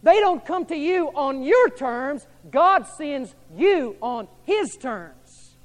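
slow emphatic preaching. Real, not acted.

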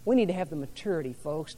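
ordinary talk

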